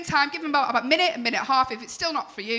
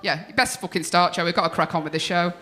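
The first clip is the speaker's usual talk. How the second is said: ordinary delivery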